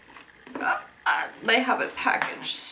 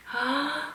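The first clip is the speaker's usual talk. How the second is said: short, high, soft gasp; delighted gasp; 6 semitones higher, 6 dB quieter than usual. Real, not acted. Looking at the back of the tape, delighted gasp.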